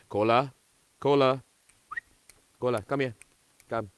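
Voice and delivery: calling the dog. Real, not acted.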